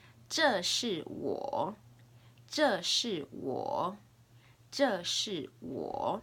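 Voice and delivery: slow, deliberate Mandarin for learners; teacher's drawn-out demonstration; slow language model. Shown as a demonstration. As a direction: model each word slowly and distinctly. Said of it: "I'll say it three times".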